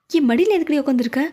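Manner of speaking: usual speaking voice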